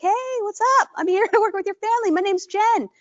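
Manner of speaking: bright, high eager hello to a family; cheerful home-visit greeting; smiling greeting. Shown as a demonstration. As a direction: greet them beaming with a laugh. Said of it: "you're smiling".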